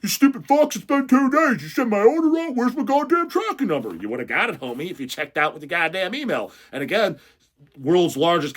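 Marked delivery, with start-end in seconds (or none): 0.0-3.9 high, loud ranting customer impression; mock angry yelling. Store owner imitating customers yelling for tracking, high mock tantrum. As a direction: huff and puff in a hammed-up yell. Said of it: "you're all huffing and puffing and yelling".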